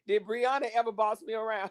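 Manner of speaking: usual speaking voice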